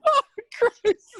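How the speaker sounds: loud laughing exclamation; gasping out through laughter. Real, not acted. Overcome with laughter.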